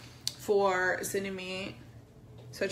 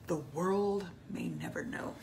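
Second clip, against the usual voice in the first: whisper; 4 dB quieter than usual. Real, not acted. Mock mysterious aside.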